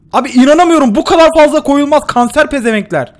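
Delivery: loud, high outraged rant. Real, not acted. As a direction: complain furiously to the viewers. Çok fazla mayın var. (öfkeli isyan)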